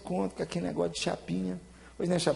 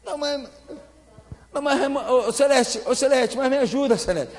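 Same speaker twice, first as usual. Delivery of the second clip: higher, louder pleading call; 7 semitones higher, 3 dB louder than usual. Real, not acted. Preacher calling his wife Celeste for help, loud pleading. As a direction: call out to her for backup, half laughing.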